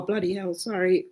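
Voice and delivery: normal talking voice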